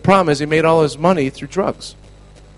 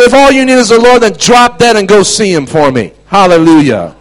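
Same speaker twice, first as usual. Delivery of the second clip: loud, raised preacher's curse; shouted rebuke; 5 semitones higher, 7 dB louder than usual. Retold or imitated. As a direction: pronounce judgment on them at high volume. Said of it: "I started talking like that".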